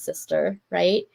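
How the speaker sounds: ordinary talk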